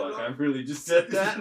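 loud, laughing disbelief. Retold or imitated. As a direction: blurt it out while laughing. Self quote after accent reply.

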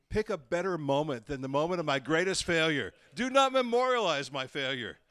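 loud, rising to a high exclamation; emphatic plea. Pretend. Preacher begging his congregation about statues, loud emphatic plea.

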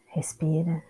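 unhurried meditation guide with long pauses; calm instructions in short, spaced-out phrases; guided meditation. Real, not acted. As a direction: guide them through the body, leaving space after each instruction.

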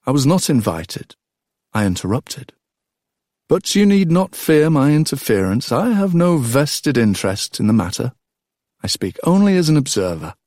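a relaxed, smiling man teasing the proud king; amused Odysseus. A narrator's reading. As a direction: tease the king with cheerful calm.

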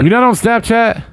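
high disbelieving question; can't believe it; incredulous. Real, not acted. Disbelief at friend.

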